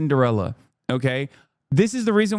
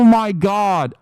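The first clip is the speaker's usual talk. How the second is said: normal talking voice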